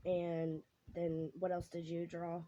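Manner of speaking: ordinary talk, nothing marked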